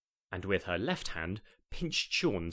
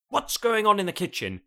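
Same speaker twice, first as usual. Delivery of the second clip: higher, louder, suspicious call from another room; voice of authority; 9 semitones higher, 7 dB louder than usual. Acted. Jane calling from the dining room, authoritative. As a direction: demand it like the boss of the house. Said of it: "came the voice of authority".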